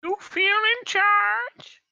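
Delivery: put-on high, very slow baby menace; baby villain voice. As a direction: drag it out, tiny and sinister. Baby Bane voice continued.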